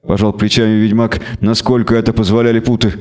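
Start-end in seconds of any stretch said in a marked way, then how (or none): none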